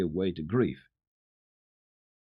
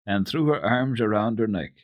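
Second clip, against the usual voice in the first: ordinary delivery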